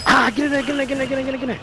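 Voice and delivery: high, frantic burst of urging; rapid-fire chant climbing high with excitement; excited urging. Real, not acted. Gamer pushing through a gunfight, excited high-pitched urging.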